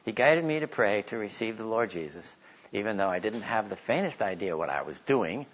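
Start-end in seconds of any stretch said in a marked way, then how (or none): none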